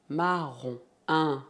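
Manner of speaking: normal talking voice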